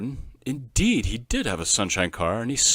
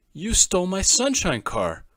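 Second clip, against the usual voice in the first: accusing; 8 semitones higher, 8 dB louder than usual. A narrator's reading. Boy accusing friend.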